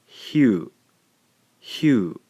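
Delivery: hue said as one glide; sound hue. Shown as a demonstration. Teacher modelling the blended sound hue. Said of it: "gives us the sound".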